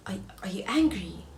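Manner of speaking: soft unvoiced question; nervous date whispering; timid whisper. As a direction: ask nervously. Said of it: "he's like".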